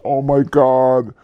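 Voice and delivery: slow, drawn-out cry of mock panic; hammed-up dismay at a loss; mock freak-out. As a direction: imitate an investor freaking out, stretching the words. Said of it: "completely freaks out and goes".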